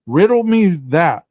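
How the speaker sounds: light, slow Riddler-style challenge; Riddler taunt. Retold or imitated. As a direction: tease it like a comic villain.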